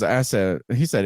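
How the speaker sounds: ordinary talk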